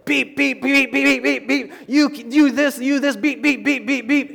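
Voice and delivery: high, loud mock screaming with bleeps; screamed censored tirade. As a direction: scream the beeps in place of swearing. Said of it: "screaming. He's like".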